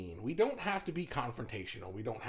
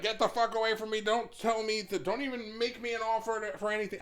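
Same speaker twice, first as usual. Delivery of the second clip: higher-pitched mock outburst; mock angry refusal; 6 semitones higher than usual. Telling someone to back off, mock angry. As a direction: rattle off a hammed-up rejection. Acted rude refusal he never says.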